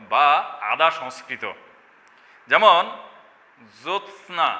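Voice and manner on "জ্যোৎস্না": জ্যোৎস্না with the t sounded before sna; careful formal pronunciation. Shown as a demonstration. Teacher presenting a Sanskrit word, careful full pronunciation. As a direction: articulate জ্যোৎস্না fully as jot-sna. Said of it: "এটি উচ্চারণ করতে অনেকের দাঁত ভেঙে যাওয়ার".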